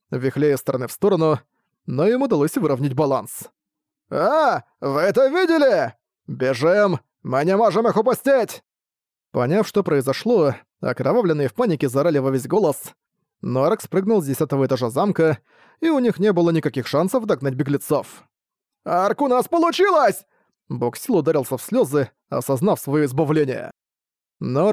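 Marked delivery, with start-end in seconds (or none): 4.1-8.6 alarmed shouting. Acted. Окровавленные видят побег. 18.8-20.3 joyful shout. Acted. Буксил ликует после прыжка.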